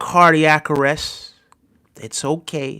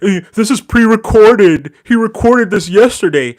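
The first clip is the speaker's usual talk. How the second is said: mock doubting viewer; 2 semitones higher, 4 dB louder than usual. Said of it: "Before anybody's like".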